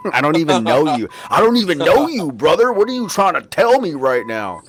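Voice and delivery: high, loud disbelieving outburst; incredulous. Retold or imitated. To a stranger yelling at him, incredulous high outburst. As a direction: sound baffled and affronted, pitch up. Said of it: "And I'm like".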